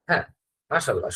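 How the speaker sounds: ordinary talk, nothing marked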